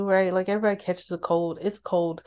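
ordinary talk, nothing marked

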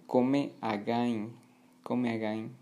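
"come again" read as written, "co-meh ah-gah-een"; spelling pronunciation. Shown as a demonstration. Teacher showing how it is written, Spanish-style spelling pronunciation. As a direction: sound out "come again" letter by letter in Spanish, slowly. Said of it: "Y se escribe".

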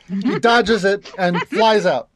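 usual speaking voice

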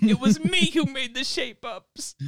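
hammed-up falsetto pride; high mock boast. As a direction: claim it slowly in a squeaky voice. High slow proud claim.

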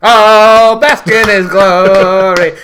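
high, loud and slow, savouring each word; hammed-up glory call; mock grand proclamation. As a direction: proclaim it like a preening wrestler. Mock grand wrestler entrance.

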